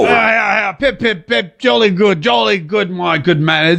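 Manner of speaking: tossing off mock British pleasantries; mock British accent. Host mocking British politicians in a light posh British accent. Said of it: "every time a British politician speaks, it's like listening to a Monty Python skit".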